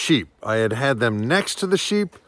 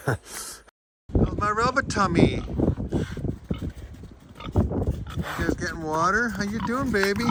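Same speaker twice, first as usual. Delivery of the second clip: high cooing pet voice; 10 semitones higher than usual. Real, not acted. Owner cooing to her pet pig at feeding time, high and sweet. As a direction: coo at the pigs like babies.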